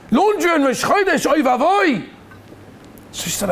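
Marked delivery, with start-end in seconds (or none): 0.1-2.1 mock outrage. Upset boss to laundry woman over Rosh Chodesh work, mock outrage.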